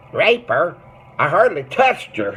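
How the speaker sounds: put-on perky old-timer protesting innocence, loud and high.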